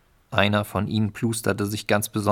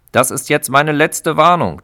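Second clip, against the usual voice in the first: higher, much louder warning; threatening; 7 semitones higher, 10 dB louder than usual. Acted. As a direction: threaten them hard. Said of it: "drohte er".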